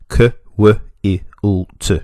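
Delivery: quilt split into separate short sounds; sounded out. Shown as a demonstration. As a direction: say K, W, I, U, T as isolated phonemes, not letter names. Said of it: "segmenting and sym".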